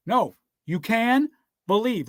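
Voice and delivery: ordinary delivery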